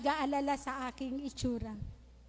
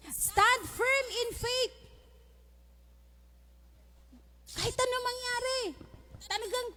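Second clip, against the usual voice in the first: higher, intense pulpit voice; fervent preaching; 6 semitones higher than usual. Acted. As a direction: drive the points home with fire. Preaching at raised pitch.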